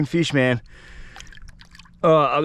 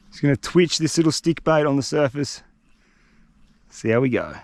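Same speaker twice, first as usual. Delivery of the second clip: ordinary talk, nothing marked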